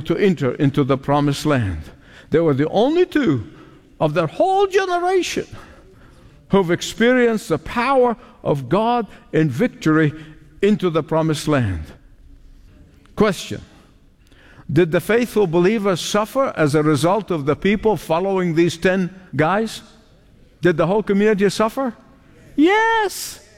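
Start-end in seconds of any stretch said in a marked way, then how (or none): 4.0-5.5 high emphatic rise. Acted. Sermon emphasis, pitch leap. 22.6-23.5 bright high answer; emphatic high yes. Acted. Answers own question, high.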